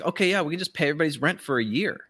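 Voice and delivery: normal talking voice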